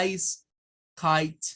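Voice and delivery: ICE and KITE as "ais", "kait"; two isolated words, silent E; English pronunciation demo. Shown as a demonstration. Teacher showing the E is mute, careful demo. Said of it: "no suena".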